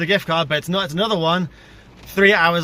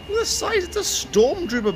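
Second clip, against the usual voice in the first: squeaky, much higher in disbelief; astonished. Real, not acted. Vlogger gasping at a giant balloon, astonished. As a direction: let it jump up in shock at the size.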